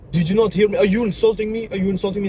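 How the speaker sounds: high-pitched mock-offended teacher; acting all mean. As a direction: imitate a teacher pretending to be outraged. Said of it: "he was acting all mean".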